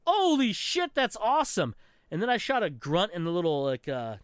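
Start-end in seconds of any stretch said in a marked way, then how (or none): 0.1-1.7 much higher, wide-eyed gamer delight; thrilled exclamation. Retold or imitated. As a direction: squeal it with amazement. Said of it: "I was like".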